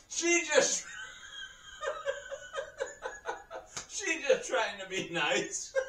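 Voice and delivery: high, breathless real giggles between words; can't stop laughing. Real, not acted. Storyteller explaining the nurse's kindness, helplessly laughing. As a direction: collapse into laughter mid-sentence. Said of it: "I can't tell this story without laughing".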